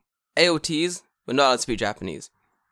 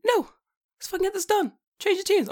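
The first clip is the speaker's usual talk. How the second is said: mock fed-up rant; 3 dB louder than usual. Retelling snapping at kids, fed up.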